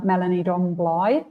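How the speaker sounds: ordinary talk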